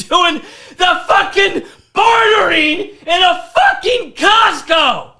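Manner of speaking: high, shouted rant building to a screaming peak; hammed-up exasperated yelling, stretched out at the climax; acted furious outburst. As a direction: lose it on the customer, re-enacted at full force. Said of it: "you can't just fucking have that kind of an outburst".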